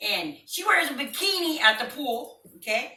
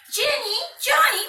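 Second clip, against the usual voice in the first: calling out; 12 semitones higher, 4 dB louder than usual. Retold or imitated. Mom calling kids.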